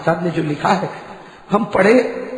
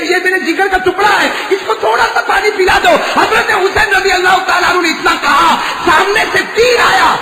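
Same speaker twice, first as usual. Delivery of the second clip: impassioned preaching; 10 semitones higher than usual. Acted. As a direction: plead for water for the child. Climactic preaching, father's plea.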